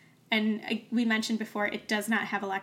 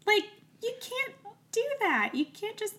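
incredulous; 11 semitones higher than usual. Real, not acted. Outraged at the constable's threat.

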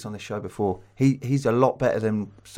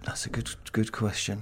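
whispering; 2 semitones lower, 8 dB quieter than usual. Real, not acted. Thinking aloud to himself.

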